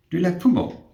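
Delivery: high, quick imitation of the patient; mimicking the patient's African accent lightly; patient's question. Patient asking nurse mid-procedure, lightly imitated.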